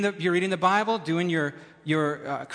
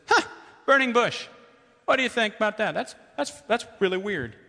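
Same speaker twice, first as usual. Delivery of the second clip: casually puzzled Moses; 6 semitones higher than usual. Pretend.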